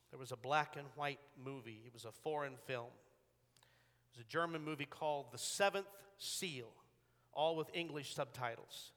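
ordinary delivery

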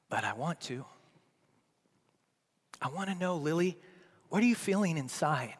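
very soft, halting, long pauses; tender, tearful dad. Retold or imitated. Dad asking his crying daughter what hurts, tender. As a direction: coax it out of your child gently. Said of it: "now I'm crying".

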